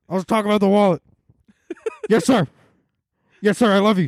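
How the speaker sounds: high, barked recruit voice; drill sergeant. Pretend. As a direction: answer like a nervous recruit. Military role continues.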